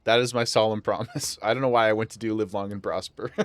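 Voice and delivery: ordinary talk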